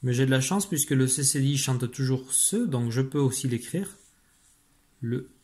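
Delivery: usual speaking voice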